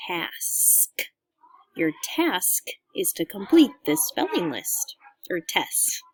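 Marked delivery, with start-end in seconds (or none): 0.1-1.1 soft whispered repeat of the word; whispering. Shown as a demonstration. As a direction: breathe the word out without voice.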